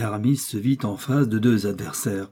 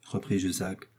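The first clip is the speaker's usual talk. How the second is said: plain reading voice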